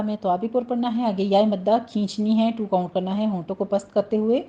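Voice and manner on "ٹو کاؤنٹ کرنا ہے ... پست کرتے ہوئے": ordinary delivery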